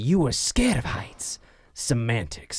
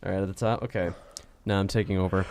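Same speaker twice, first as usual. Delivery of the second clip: usual speaking voice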